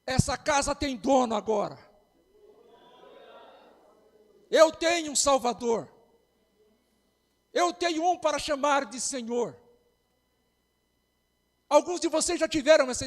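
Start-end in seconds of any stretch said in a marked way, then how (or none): 0.0-9.6 loud, decisive outburst; firm declaration. Retold or imitated. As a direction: bang on the table and declare it. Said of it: "desse aquele murro na mesa e fala".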